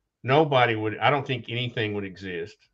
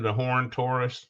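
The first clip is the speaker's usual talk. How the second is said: normal talking voice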